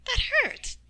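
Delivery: high, loud yelp of protest; pained outcry. Acted. Shireen hurt after stuff pulled off.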